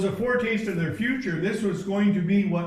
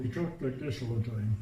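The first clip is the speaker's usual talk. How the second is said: a lightly done closed-mouth mumble, lower and softer; mouth barely open; 9 semitones lower, 7 dB quieter than usual. Retold or imitated. Preacher imitating an Inuit man talking through closed lips. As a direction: speak without opening the mouth, low and soft. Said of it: "he never opened his mouth when he spoke".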